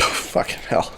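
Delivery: whispered curse dissolving into a high giggle; can't hold back the laugh; breathless laughter. Real, not acted. Recapping the movie, cracking up with a whispered curse.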